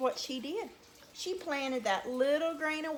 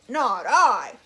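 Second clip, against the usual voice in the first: loud, barked gruff voice; gruff it out like a dog; 2 semitones lower, 12 dB louder than usual. Shown as a demonstration. Storyteller showing children how a dog would say it, loud and gruff. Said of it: "He'd sort of gruff it".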